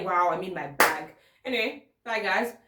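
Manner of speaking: ordinary talk, nothing marked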